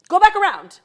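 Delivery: very loud, high mock command; yelled order. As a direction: imitate an official yelling someone back into line. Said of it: "be yelling at me".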